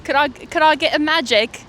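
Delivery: hammed-up Australian coffee order; Australian accent. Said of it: "should we switch to an Australian accent".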